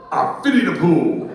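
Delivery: loud, gruff catchphrase parody; Mister T. Acted. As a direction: bark it out as a punchline impression. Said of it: "What did Mister T say".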